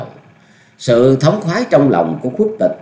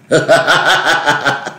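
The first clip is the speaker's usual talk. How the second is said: high, hearty "ha ha" cackle; loud villainous guffaw; 5 semitones higher than usual. A narrator's reading. Old master laughing at enemies' wounds, gleeful guffaw. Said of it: "cười lớn ha hả nói".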